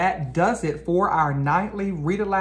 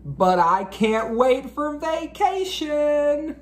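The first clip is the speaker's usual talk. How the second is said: slow, much higher gleeful teacher; excited; 11 semitones higher, 4 dB louder, slower than usual. Acted. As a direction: savor the thought of vacation.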